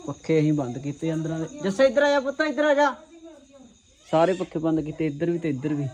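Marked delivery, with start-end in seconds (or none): 1.6-2.9 calling out. Real, not acted. Calling child over, high.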